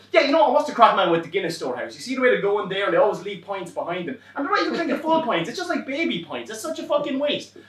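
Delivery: eager griping. Retold or imitated. Appeasing driver.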